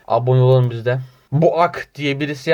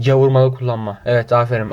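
ordinary delivery

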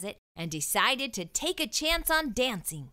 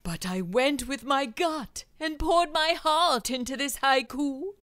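a touch higher, mildly pleading; 5 semitones higher than usual. Acted. Wilfred pleading with the editor, mildly. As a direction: beg a little for your poem. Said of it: "he pleaded".

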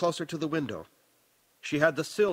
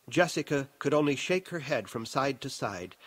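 usual speaking voice

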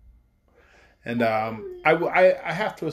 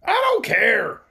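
scowling; 5 semitones higher, 4 dB louder, much slower than usual. A narrator's reading.

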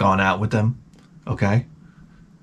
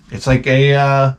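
ordinary talk

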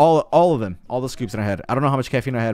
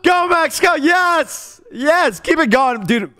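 high, loud chant of encouragement; frantic cheering; 6 dB louder than usual. Real, not acted. Streamer cheering Max on in the game, loud chanting. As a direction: cheer at the top of your voice.

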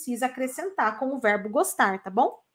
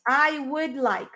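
slow full form; slower than usual. Shown as a demonstration. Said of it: "vou deixar ele escrito inteiro".

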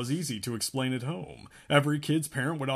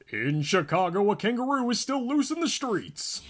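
higher, loud radio news announcer; 10 semitones higher, 5 dB louder than usual. Acted. Radio announcer reading news to the classroom, loud and high. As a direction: boom it out like a radio bulletin. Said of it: "an announcer was loudly saying".

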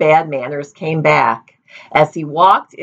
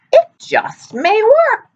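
put-on old woman; slower than usual. A narrator's reading.

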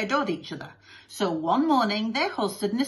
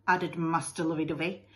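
plain reading voice

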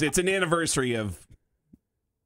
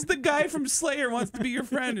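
lightly higher girlfriend voice, a touch mocking; imitating girlfriend; 14 semitones higher than usual. Retelling his girlfriend's insistence to hosts, lightly mock higher. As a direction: echo her insistence in a higher voice. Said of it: "Just like that, too, I bet".

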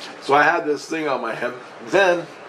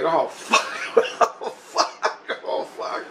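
retold panic; 5 semitones higher, 3 dB quieter, much slower than usual.

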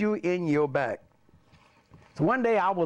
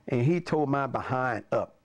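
plain reading voice

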